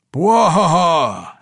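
slow, loud, high booming dwarf guffaw; dripping dwarf howling with laughter. Acted. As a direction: roar with laughter. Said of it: "howled the equally dripping Athrogate".

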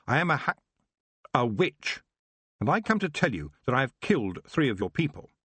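put-on high, resolute young witch; firm. A narrator's reading. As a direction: declare yourself firmly to the king. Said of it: "she said firmly".

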